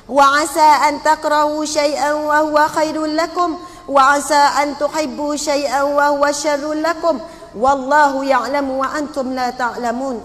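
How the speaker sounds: measured Arabic scripture recitation, projected strongly; bacaan ayat al-Quran. Retold or imitated. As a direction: recite the verse loudly and firmly. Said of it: "saya baca ayat itu berbunyi". (bacaan ayat suci yang lantang)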